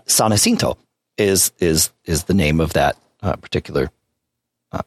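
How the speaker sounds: ordinary delivery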